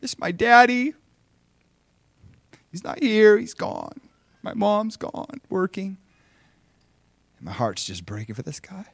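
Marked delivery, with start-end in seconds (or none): 0.0-4.0 put-on high, slow, loud little-boy wail; imitated crying child. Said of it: "he just starts crying". 4.4-6.0 put-on high, slow little-boy lament; imitated tearful child. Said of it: "he just starts crying".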